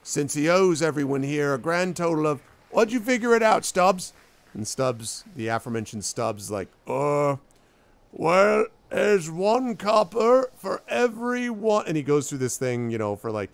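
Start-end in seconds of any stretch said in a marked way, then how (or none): 0.0-4.1 higher, raised voice of an aggrieved creditor; indignant bar patron. Acted. Indignant patron explains the debt. 6.9-11.9 high, loud, drawn-out figuring; slow reckoner. Acted. Stubbs slowly working out the sum.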